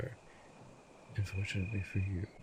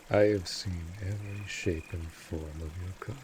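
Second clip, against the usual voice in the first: usual speaking voice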